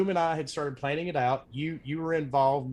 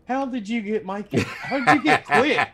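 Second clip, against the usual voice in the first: high, loud, laughing amazement; excited disbelief; 7 semitones higher, 7 dB louder than usual. Retold or imitated. Dizzy messaging the host, thrilled at the surprise guests, loud laughing disbelief. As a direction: blurt it out half-laughing, thrilled.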